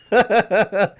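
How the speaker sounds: loud real laugh; quick high laugh; burst of laughter. Real, not acted. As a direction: laugh out at the chat. Laughing at Bye Felicia comment.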